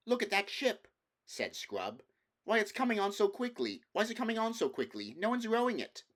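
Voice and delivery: put-on a boy staring at a runaway ship, rushing; alarmed boy. Scrub to the others at the window, alarmed and rushing.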